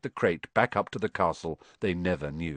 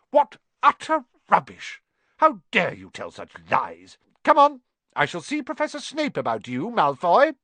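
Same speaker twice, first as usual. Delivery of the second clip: high, loud Scottish scolding; stern Scottish teacher; stern McGonagall; 9 semitones higher, 4 dB louder than usual. A narrator's reading.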